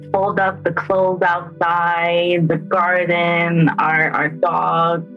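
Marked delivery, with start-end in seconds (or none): none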